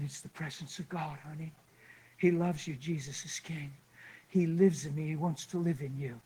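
soft, low, tender ministering voice; quiet, soothing retold comfort; gentle reassurance. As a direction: reassure her softly and calmly, leaving pauses. Friend gently ministers to crying woman.

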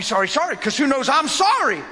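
normal talking voice

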